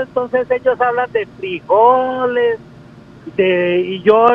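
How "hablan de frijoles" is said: "frijoles" estirado y cantadito, imitando al paisa; drawled paisa accent. Retold or imitated. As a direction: alarga despacio "frijoles" a lo paisa. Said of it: "el paisa es más arrastradito". (acento paisa arrastrado, lento y agudo)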